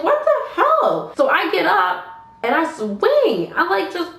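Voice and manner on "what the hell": drawn-out, high-pitched what the hell; bewildered outcry. Retold or imitated. Drunk woman dazed on the ground, high bewildered outcry. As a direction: retell it in high, stretched disbelief.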